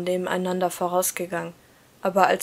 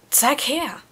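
higher, eager demand; excited demand; 6 semitones higher, 3 dB louder than usual. A narrator's reading. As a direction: reach for it eagerly. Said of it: "verlangte ich aufgeregt".

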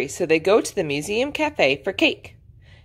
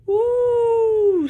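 long, high-pitched "ooh"; little piglet's drawn-out ooh of wonder; awed ooh. A narrator's reading. George marvels at big museum.

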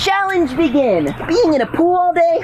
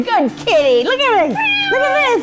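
excited shout; 8 semitones higher, slower than usual. Real, not acted. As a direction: crow about the scare. Triumphant after scare.

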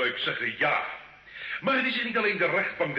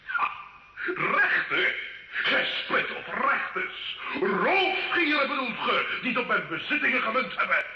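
mocking laughter at the court; scornful mockery; 8 semitones higher than usual. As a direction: sneer at the judges with a mocking laugh. Scornful accused mocks the judges.

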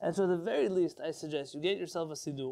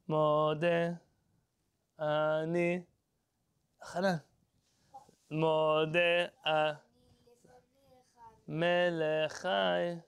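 very slow, drawn out, wrong breaks; slow school recitation; slow wrong phrasing; much slower than usual. Shown as a demonstration. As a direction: chant it slowly like a class. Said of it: "so all the schools say".

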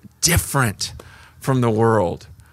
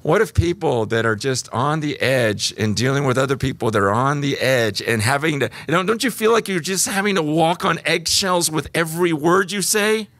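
normal talking voice

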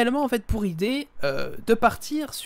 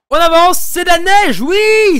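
high, loud burst of excitement at finding snow; excited about snow; 10 semitones higher, 15 dB louder, slower than usual. Real, not acted. Showing viewers the new snow cave, thrilled loud shout.

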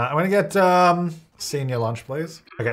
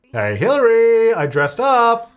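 cheery Sim greeting; 8 semitones higher, 5 dB louder, slower than usual. Pretend. Voicing his Sim greeting Hillary.

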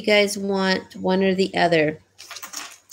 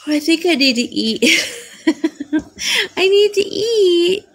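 higher-pitched, giggly words; laughing; 8 semitones higher than usual. Real, not acted. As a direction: break into giggles while talking. Giggling over needing to eat.

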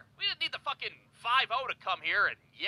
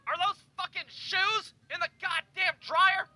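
angry neighbor; 10 semitones higher, slower than usual. Retold or imitated. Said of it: "he's like".

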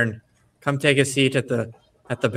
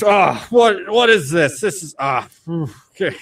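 high, loud sputtering shock easing to relief; spluttered shock, then a sigh of relief; startled gnome; 7 semitones higher, 7 dB louder than usual. Acted. Gnome doused with beer, sputtering high shock then relief. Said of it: "And the gnome's just".

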